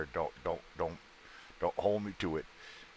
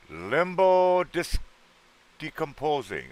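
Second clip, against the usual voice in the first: playful name reading; 10 semitones higher, 5 dB louder, much slower than usual. Acted. Reading a chatter's odd username.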